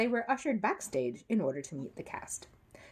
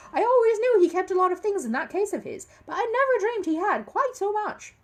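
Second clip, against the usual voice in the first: high, loud firm defence of the bear; lightly voiced stern Mrs Bird; stern housekeeper; 7 dB louder than usual. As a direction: put the stranger in his place. Mrs Bird remarks on the case.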